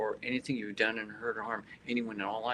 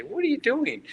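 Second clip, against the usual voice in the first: high, loud incredulous question; exaggerated disbelief in a raised voice; mock astonished onlooker; 7 dB louder than usual. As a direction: imitate a baffled passer-by, pitch shooting up. Onlookers' puzzled question acted out.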